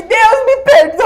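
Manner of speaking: very loud, high, drawn-out mock sobbing; retold bawling. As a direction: cry out to God, hamming up the tears. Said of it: "comecei a chorar de novo". (berreiro imitado, pedindo perdão)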